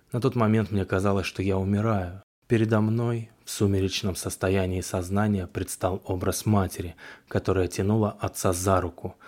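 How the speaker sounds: ordinary delivery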